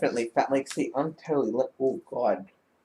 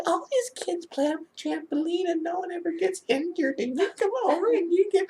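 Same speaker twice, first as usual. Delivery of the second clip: high, softer squeaky voice; imitated friend's lament; 11 semitones higher, 8 dB quieter than usual. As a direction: mimic a friend complaining in a small high voice.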